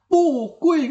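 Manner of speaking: "pu kue" high, "pu" not rising; bad-sounding tones. Shown as a demonstration. Teacher showing bad reading, "pu kue" with unchanged "pu". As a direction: hold "pu" high before "kue". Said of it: "bunyinya tidak bagus".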